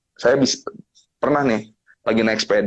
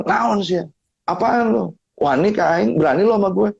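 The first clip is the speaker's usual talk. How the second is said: hostile challenge; 6 semitones higher than usual. Retold or imitated. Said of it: "Terus dia bilang".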